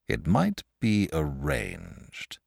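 slow, musing and thoughtful. A narrator's reading. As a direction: George considering a compromise, slow and thoughtful. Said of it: "said Saint George thoughtfully".

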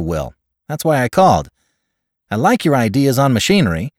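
high, loud, quick-talking caller; clipped exact voice. A narrator's reading. Caller tells his friend he's wrong this time, clipped and exact. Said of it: "He had the same clipped, exact voice".

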